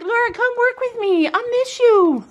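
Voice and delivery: high pleading horse voice; voicing the horse. Vlogger voices her horse calling her to work. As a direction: speak for the mare, high and sweet. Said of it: "She's like".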